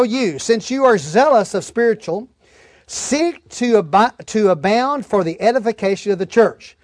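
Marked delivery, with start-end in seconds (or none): none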